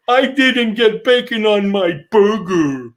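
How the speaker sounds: high, slow put-on whine; mocking impression of a pathetic complainer; whiny complaining customer. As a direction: draw out the complaint in a high mock voice. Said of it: "one arm not working, going".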